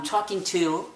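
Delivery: aspirated t in talking, English style; English accent. Shown as a demonstration. Teacher demonstrating corrected English accent. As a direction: say it with a breathy English t. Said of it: "जब मैं इसको change करता हूँ, मैं कहता हूँ".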